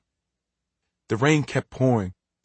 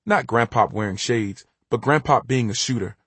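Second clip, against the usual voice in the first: ordinary delivery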